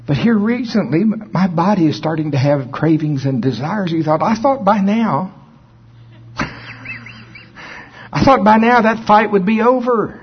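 high, strained voice of a frustrated believer; imitating a frustrated man. Man complaining to pastor about cravings, high and strained. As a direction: play up his whiny disbelief. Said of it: "and he says".

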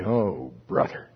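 low, slow weary "oh brother"; mock exasperated Norval. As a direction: drag it out like a fed-up old friend. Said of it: "Norval would say".

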